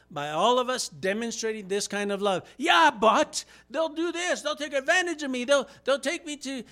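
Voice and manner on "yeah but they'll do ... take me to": high, loud protesting excuse voice; whiny objector. Pretend. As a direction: cry out the excuses of someone afraid of being used. Imagined objector to sacrificial love.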